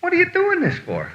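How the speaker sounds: high, strained protest from the waiter; waiter's outburst. Retold or imitated. Waiter protesting to the customer, high and worked up. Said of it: "He said".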